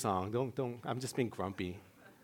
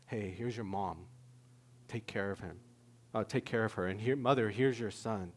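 Jesus speaking softly from the cross; 8 semitones lower, 9 dB quieter than usual. Retold or imitated. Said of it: "she hears him on the cross say".

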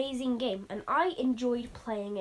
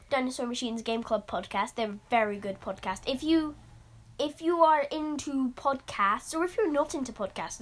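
usual speaking voice

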